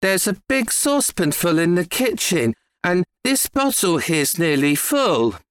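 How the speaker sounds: a hint of an earnest lad; boy's reply. A narrator's reading. Boy answers his father.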